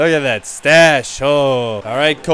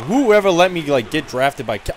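ordinary talk, nothing marked

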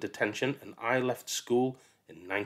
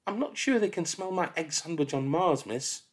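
put-on cheeky child; 7 semitones higher than usual. Child answers back.

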